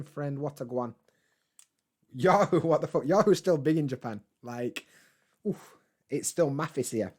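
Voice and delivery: ordinary delivery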